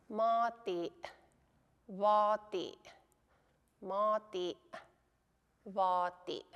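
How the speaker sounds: "mati" and "vati" each cut off in the throat; final glottal catch. Shown as a demonstration. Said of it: "have a little catch in your throat".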